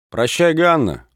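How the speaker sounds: high, loud playful call; loud mock serenade farewell; shouted farewell. A narrator's reading. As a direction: yell a cheeky goodbye to the girl. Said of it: "закричал в это время один".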